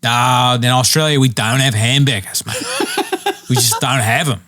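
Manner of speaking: mock Australian complaining about hamburgers; lightly drawn Australian bloke; Australian accent. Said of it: "he was like".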